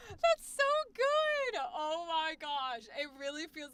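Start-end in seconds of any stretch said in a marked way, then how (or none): 0.1-1.6 high, loud, stretched-out squeal; gushing delight. Real, not acted. Streamer reacting to the actor's replayed audio, gushing squeal.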